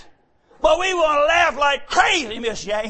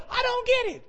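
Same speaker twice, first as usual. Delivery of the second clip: high, softer mock-confused quote; higher-pitched imitation of not getting it; puzzled listeners; 9 semitones higher, 7 dB quieter than usual. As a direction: imitate a baffled crowd up high. Retold puzzled listeners, acted.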